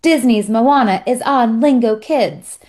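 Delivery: slow, playful mock excitement; excited kid imitation. Mother quoting her kid who comes running in, excited kid imitation. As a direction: quote a thrilled kid, drawing the words out. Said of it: "completely losing their mind".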